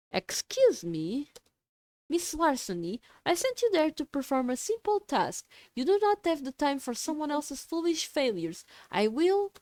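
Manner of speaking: high, imperious villain boss; haughty boss voice. Acted. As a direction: scold like a cult overmistress. Boss scolding over comms.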